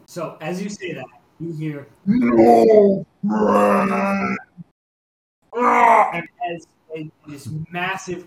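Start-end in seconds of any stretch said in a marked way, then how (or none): 2.1-4.4 loud, drawn-out creature bellow; booming monster cry; monster roar. Acted. GM voices monster bursting out. 5.6-6.1 short loud beastly roar; high, loud creature yell; monster roar. Acted. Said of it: "this creature just roars".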